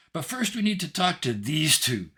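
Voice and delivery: put-on high, loud little-girl voice; tiny, cheeky Hope. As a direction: pipe up like a bossy tiny girl.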